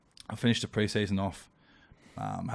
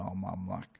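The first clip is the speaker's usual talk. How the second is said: dropped voice; 8 dB quieter than usual. Retold or imitated. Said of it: "my voice dropped straight away".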